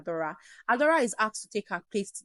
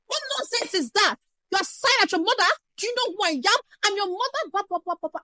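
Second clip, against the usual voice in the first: much higher, louder mock parental outrage; angry shout; 11 semitones higher, 5 dB louder than usual. Mother scolding sighing child, furious shout. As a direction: scold as if your authority was insulted. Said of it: "immediately shouting".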